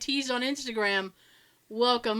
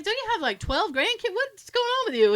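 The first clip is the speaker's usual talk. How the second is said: high-pitched retold astonishment; incredulous questioning; 9 semitones higher than usual. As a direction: imitate baffled disbelief, pitched way up.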